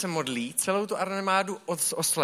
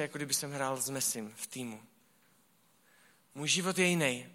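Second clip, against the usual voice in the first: usual speaking voice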